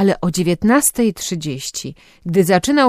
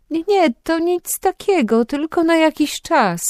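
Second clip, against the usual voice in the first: much higher, hesitant denial; uncertain voice; 11 semitones higher than usual. A narrator's reading. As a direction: nervously brush off mother's question, pitched up high. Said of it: "niepewnym głosem odpowiedziała Agata". (niepewnym, wysokim głosem)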